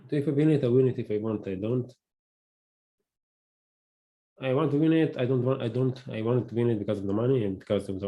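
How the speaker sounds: normal talking voice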